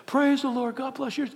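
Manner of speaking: a distracted churchgoer mouthing praise; mock worship murmur. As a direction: mumble the praise absently. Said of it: "it's like this".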